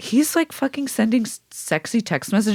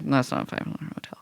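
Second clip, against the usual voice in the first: quick, hushed, whispered correction; whispered aside; 4 semitones lower, 8 dB quieter, much faster than usual. Shown as a demonstration. Correcting inflated details, a quick hushed whisper. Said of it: "It's like".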